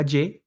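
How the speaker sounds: "Y" said as a hard, buzzy je; English J sound. Shown as a demonstration. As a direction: say "Y" like an English J plus e. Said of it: "una palabra con J, se pronuncia como".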